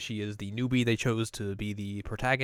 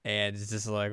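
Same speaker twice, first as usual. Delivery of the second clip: ordinary talk, nothing marked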